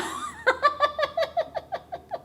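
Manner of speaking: long, high laughing fit. Real, not acted. Streamer laughing at being called really activated, long high giggles. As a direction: dissolve into high, helpless laughter.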